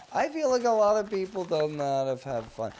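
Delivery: squeaky mock whine; high put-on voice. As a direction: jump up into a piping mock voice. Sudden put-on voice mid-rant.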